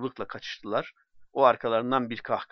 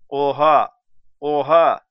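higher, louder mocking shout from afar; jeering call; 3 semitones higher, 13 dB louder, slower than usual. Onlookers jeering at the drunk man, calling out loudly. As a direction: jeer like an onlooker in a window. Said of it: "diye seslendiler".

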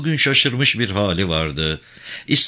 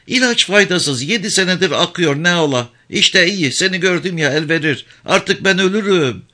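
high, weary village woman voice; resigned old mother; 8 semitones higher, 3 dB louder than usual. Acted. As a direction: shrug off her illness.

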